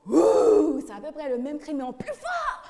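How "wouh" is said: loud playful roar; mock lion roar. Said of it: "Il a fait les lions".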